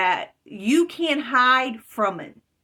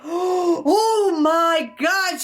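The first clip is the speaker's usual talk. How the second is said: high, slow, crying gasp of fear; scared cry; 7 semitones higher, much slower than usual. Retold or imitated. Dreamer seeing the chariot, frightened cry. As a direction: breathe it out in shock and fear. Gasps at the sight.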